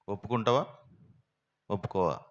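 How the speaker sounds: loud, menacing demand; shouted threat. Retold or imitated. Policeman shouting at the thief with a gun drawn, loud threat.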